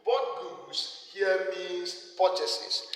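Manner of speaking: very slow, every word weighted: bought goods here means purchases; deliberate teaching emphasis; emphasized slowly. Shown as a demonstration. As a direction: spell it out for students, word by word. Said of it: "I emphasize that in accounting".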